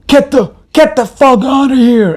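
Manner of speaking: very high, loud mock yell; strained high shouting, acted out; angry man. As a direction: imitate an enraged man ordering someone away. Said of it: "And he's so angry".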